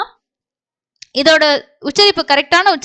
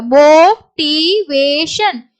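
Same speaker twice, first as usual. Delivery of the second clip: slow, long first vowel; drawn-out syllables; much slower than usual. Shown as a demonstration. Teacher shows learners the long vowel, drawn-out syllables. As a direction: stretch "motivation" syllable by syllable.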